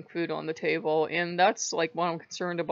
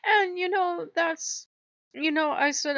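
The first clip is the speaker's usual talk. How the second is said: crying; 11 semitones higher, 4 dB louder than usual. Real, not acted. Upset talking about Mammon leaving.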